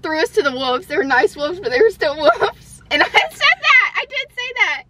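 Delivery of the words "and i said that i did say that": embarrassed squeal. Real, not acted. Admits her quote, embarrassed.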